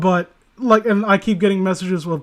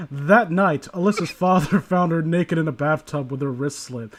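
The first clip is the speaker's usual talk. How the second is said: dry spooky story read; 3 semitones lower than usual. Acted. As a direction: read it like a creepypasta narrator. Reads a creepy story aloud.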